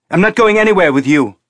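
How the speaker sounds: high, loud and fast refusal; defiant shout. A narrator's reading. Teresa refusing to go with the colonel, defiant shout. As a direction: throw the refusal back at her.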